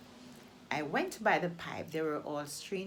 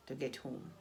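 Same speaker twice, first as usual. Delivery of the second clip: usual speaking voice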